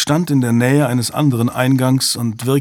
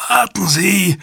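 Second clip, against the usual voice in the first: gasping; 9 semitones higher than usual. A narrator's reading. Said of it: "keuchte er".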